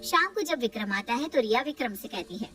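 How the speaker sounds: plain reading voice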